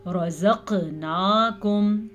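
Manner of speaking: رَزَقْنَاكُمْ chanted slowly with a bounced ق; qalqalah on qaf. Shown as a demonstration. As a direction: give the ق in رَزَقْنَاكُمْ a small echoing release. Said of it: "قاف پہ کلکلا".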